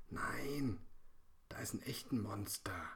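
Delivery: urgent voiceless whisper; little girl whispering her fear; frightened whisper. A narrator's reading. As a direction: insist quietly, scared. Lena insists in whisper.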